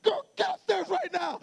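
very high, exasperated retold command; angry dad order. Dad sends daughter upstairs, acted-out angry order. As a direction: act out a father at the end of his patience.

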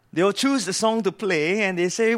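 ordinary talk, nothing marked

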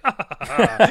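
loud mock laugh, shrugging it off; dismissive laugh. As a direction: laugh the request away. Barman laughs it off again.

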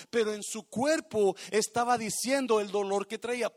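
ordinary talk

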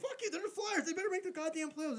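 high, heated outburst at his team; angry rant. Real, not acted. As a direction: vent it high and furious.

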